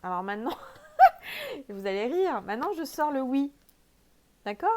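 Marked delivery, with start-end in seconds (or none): 0.6-1.6 burst of laughter. Real, not acted. Laughs at her own card.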